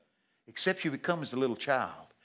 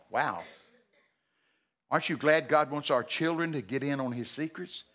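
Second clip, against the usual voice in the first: ordinary talk, nothing marked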